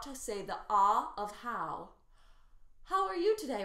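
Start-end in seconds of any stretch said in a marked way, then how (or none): 2.8-3.6 much higher and louder, eager; engaged bright greeting; excited. Shown as a demonstration. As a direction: sound thrilled to see someone. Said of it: "rather than".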